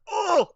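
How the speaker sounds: high, loud cry of being struck; hammed-up game character's pain yell; imitated hit cry. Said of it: "And then you hit him, he's like".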